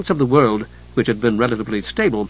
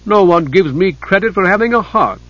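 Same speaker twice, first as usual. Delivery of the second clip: higher, louder aggrieved complaint; complaining; 6 semitones higher, 3 dB louder than usual. Acted. Deal maker Hidjukel complaining to Chislev, wounded whine. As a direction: whine that nobody credits him. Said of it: "Hidjukel complained".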